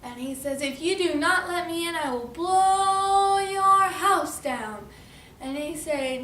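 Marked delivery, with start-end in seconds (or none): 0.6-4.9 high, loud, long-drawn storybook threat; big bad wolf threat. Wolf threatens pigs at the door, loud drawn-out menace. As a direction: build up and stretch BLOW hugely.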